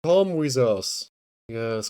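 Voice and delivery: usual speaking voice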